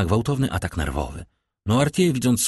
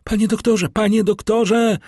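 much higher, panicked call for the doctor; stifled cry; 14 semitones higher, 4 dB louder than usual. A narrator's reading. Valentine cries for the doctor in panic. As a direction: call for help in fright. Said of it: "zawołała Walentyna stłumionym głosem".

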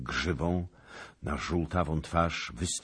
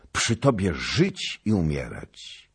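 loud, slow, raised vow; fervent pledge; 4 semitones higher, 8 dB louder than usual. A narrator's reading. Radiant stranger pledging himself to the king, fervent. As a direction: swear it with all your strength.

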